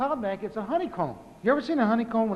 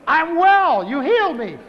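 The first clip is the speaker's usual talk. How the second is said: excited wife; 6 semitones higher, 8 dB louder, slower than usual. Retold or imitated. Healed wife tells him.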